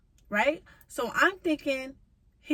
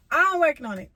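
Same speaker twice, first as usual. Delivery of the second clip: louder, through a laugh; 6 semitones higher, 7 dB louder, faster than usual. Real, not acted. Joking to viewers about her money, laughing louder. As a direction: laugh it off, bright and loud. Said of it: "y'all might think I got money".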